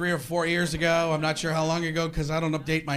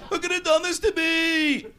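high-pitched aggrieved sitcom neighbour; Newman; 10 semitones higher than usual. Acted. Neighbour asks who did this to him, Newman impression. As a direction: wail the question in a high voice. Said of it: "he took the Newman character".

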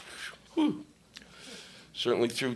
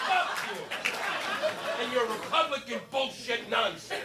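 much higher, hammed-up cry of offense; mock outraged heckler; 8 semitones higher, slower than usual. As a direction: parody a furious heckler. Mocking angry Republicans.

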